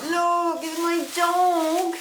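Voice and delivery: high, drawn-out happy wail; overcome, near tears, voice rising high; really moved, words stretched out; choked up. Real, not acted. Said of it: "I'm getting a bit choked up here".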